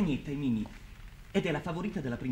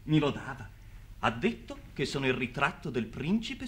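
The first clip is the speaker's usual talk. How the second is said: usual speaking voice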